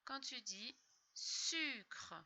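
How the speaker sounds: quand tu dis sucre said slowly; patient slow prompt; slow instruction. Shown as a demonstration. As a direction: draw out the phrase for a child. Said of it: "slow modelling".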